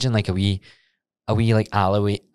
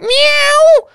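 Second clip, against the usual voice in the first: imitated loud meow; 9 dB louder than usual. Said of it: "It was like".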